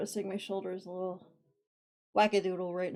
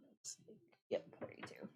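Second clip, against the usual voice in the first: whisper; 13 semitones higher, 14 dB quieter than usual. Real, not acted. Counting stitches under breath.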